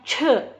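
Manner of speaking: "C" as ch, tongue up, with a puff; aspirated retroflex CH. Shown as a demonstration. Teacher giving the CH example, aspirated. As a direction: curl the tongue and push air out on "C". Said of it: "terus keluar anginnya".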